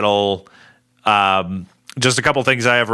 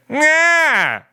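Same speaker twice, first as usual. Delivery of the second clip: high-pitched cat-man wail, drawn out; imitated character sound. Host imitates the cat-man's funny pre-fight sound, high drawn-out wail. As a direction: mimic a funny high "nyaaah" battle cry. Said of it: "the sound that he makes".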